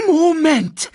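very high, loud squawk; screech. A narrator's reading. Sasu coming in to land, screeching. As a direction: screech to stop them at once. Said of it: "kreischte Sasu".

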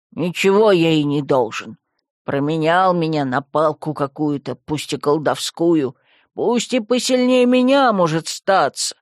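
low, slow reluctant creature voice; grudging skull. Acted. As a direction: skull admitting it unwillingly. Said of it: "с неохотой признал он". (неохотно, низким голосом)

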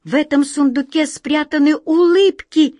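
high, loud, excited announcement; amazed discovery. Acted. Wizard telling the cuckoo the secret, excited. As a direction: proclaim the find with astonishment.

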